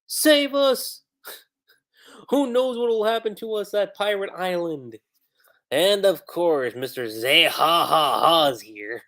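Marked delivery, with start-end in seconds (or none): 0.1-5.0 pleading townsfolk. Retold or imitated. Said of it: "after all".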